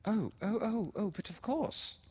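put-on high, stammering, anxious girl; Laura, flustered. A narrator's reading. A frightened girl apologising to the widow's sister, flustered. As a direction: stumble over the words, panicked. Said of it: "said Laura".

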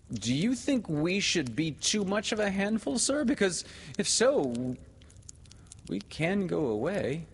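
put-on high-pitched boy's voice; proud, flushing boy. A narrator's reading. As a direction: ask high, with wounded pride. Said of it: "he asked, flushing".

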